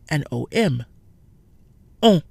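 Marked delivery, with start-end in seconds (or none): 2.0-2.2 "On" rounded and nasal; French nasal "On". Shown as a demonstration. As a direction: say "On" through the nose, lips rounded. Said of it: "We have on".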